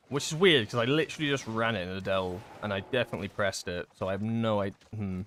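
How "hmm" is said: ordinary talk, nothing marked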